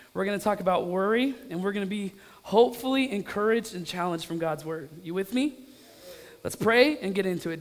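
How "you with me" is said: ordinary delivery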